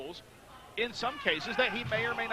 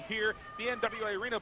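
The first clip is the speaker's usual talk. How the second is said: ordinary delivery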